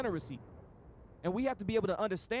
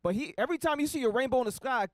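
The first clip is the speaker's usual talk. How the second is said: usual speaking voice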